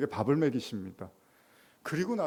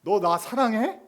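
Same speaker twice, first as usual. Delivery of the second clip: Jesus' question; 10 semitones higher, 6 dB louder, slower than usual. Acted. Jesus asking Peter.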